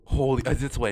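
ordinary delivery

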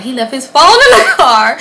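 acted-out excitement; excited discovery. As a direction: blurt out the gleeful discovery. Acts out her excited discovery.

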